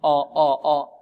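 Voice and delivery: آ thick and dark, toward aw; wrong heavy hamza. Shown as a demonstration. Teacher isolating the students' mistake, heavy dark آ. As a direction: repeat آ with a heavy, backed vowel. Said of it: "نفخم الهمزة".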